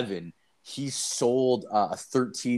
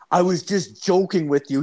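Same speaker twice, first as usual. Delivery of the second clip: high, louder imitation of an urgent friend; panicked buddy; 7 semitones higher, 5 dB louder than usual. Buddy grabbing his friend to flee, imitated panic. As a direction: plead urgently, pitched up, as a retold panic.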